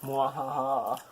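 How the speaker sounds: mock evil laugh; sneering panto villain. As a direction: cackle like a pantomime baddie. Teasing partner in taste challenge.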